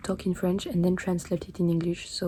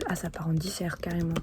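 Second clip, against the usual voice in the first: usual speaking voice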